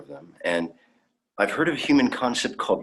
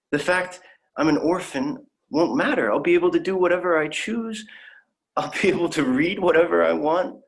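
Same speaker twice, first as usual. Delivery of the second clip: higher and louder with eager hope; excited; 8 semitones higher, 4 dB louder than usual. Acted. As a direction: gush about the dream life. Dreaming of life in utopia.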